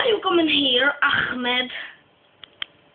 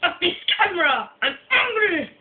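high, hammed-up rage; exploding upward in mock fury; angry outburst; 4 semitones higher than usual. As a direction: yell it as a comic tantrum. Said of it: "[name], are you angry".

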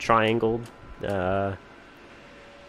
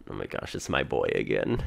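ordinary talk, nothing marked

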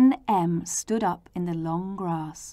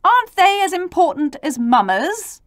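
high, raised peeved question; pleading; 10 semitones higher, 6 dB louder than usual. A narrator's reading. Pouting P asking about papas and mummas, pleading. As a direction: whine a hurt protest, pitched up. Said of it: "he pleaded".

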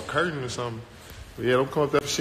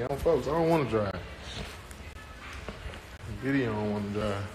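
usual speaking voice

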